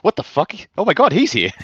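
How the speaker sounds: high-pitched mock gasp of disbelief; shocked astonishment. Crowd discovering Wit is there, mock shocked astonishment. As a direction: act out a startled onlooker. Imagined crowd startled by Wit's presence.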